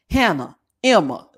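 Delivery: ordinary talk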